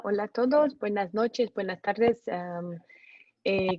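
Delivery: ordinary talk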